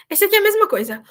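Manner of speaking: ordinary talk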